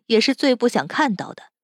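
ordinary delivery, nothing marked

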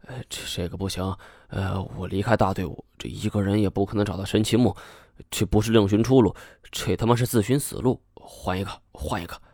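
hushed and quick, fading to almost nothing; hiding his anger from the group, fading murmur; very quiet. A narrator's reading. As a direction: keep it down so no one turns round. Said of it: "用一种极其轻微的语调说".

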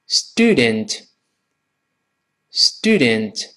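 student slowly as "stoo-dent"; spaced model of student, falling at the end; careful American model. Shown as a demonstration. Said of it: "读起来". (美式慢读 student)

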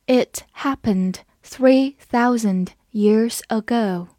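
slow, word-by-word model reading; unhurried teacher's demonstration for repeating; slow read-through. Shown as a demonstration. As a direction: read it slowly with small gaps between phrases. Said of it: "我们慢读一遍".

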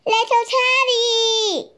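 high, calling across the playground; shouted. A narrator's reading. Monkey calling to little Teddy, eager high shout. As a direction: call his friend over, high and loud. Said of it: "shouted Monkey".

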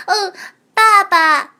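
thin reedy voice. Acted. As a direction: call it out like a small baby creature. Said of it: "小家伙细声细气地叫了一声".

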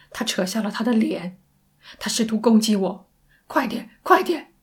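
loud, urgent report rising in pitch; panicked caller. Acted. Sandy telling operator of the attack, panicked. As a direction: blurt it out, climbing into a plea.

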